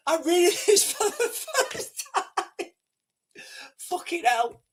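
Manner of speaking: high, breaking up mid-sentence; squeaky, tearful laughing speech; real helpless laughter. Real, not acted. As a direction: laugh so hard the words give out. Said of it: "I shouldn't be laughing".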